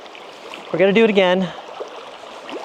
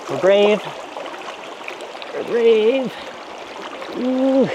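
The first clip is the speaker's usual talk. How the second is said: self-encouraging; 7 semitones higher, slower than usual. Real, not acted. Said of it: "psyching self up in cold water".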